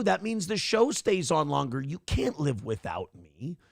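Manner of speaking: ordinary delivery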